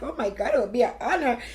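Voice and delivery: touched, crying. Retold or imitated. Teller recalling her friend's offer, voice tearful and moved. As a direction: relive being moved to tears. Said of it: "So I started crying".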